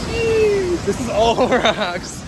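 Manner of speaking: high, loud burst of awe; awed jeez. Real, not acted. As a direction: gasp it out, amazed. Awed at huge waterfall.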